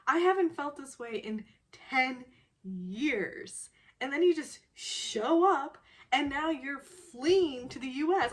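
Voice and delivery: half-acted agitated plea; upset retold outburst. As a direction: play his frantic disbelief, loud. Quoting the distraught male lead.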